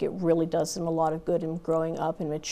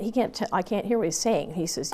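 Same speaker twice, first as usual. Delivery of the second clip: usual speaking voice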